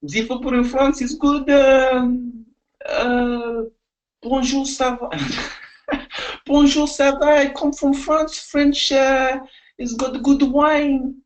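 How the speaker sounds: high-pitched put-on French accent; halting, hammed-up French English. Shown as a demonstration. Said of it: "my turn".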